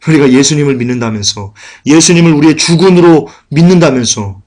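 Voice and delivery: ordinary talk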